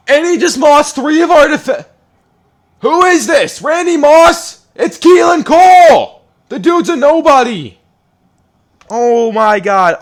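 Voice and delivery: very high, very loud furious screaming; raging shout. Real, not acted. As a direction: scream at the screen in disbelief. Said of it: "all the yelling and screaming and the raging".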